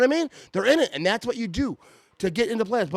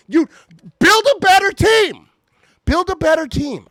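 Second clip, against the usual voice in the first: ranting shout; 9 semitones higher, 7 dB louder than usual. Real, not acted. Ranting at fans.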